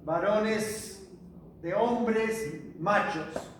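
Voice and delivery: "de hombres machos" drawn out slowly, each word weighted; slow emphasis. Real, not acted. To the Bible class, explaining aner, slow deliberate emphasis. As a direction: spell it out slowly for the congregation.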